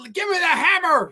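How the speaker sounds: high-pitched mock plea; acted movie cry. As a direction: imitate a desperate demand, pitched high. Acting out a line from scene.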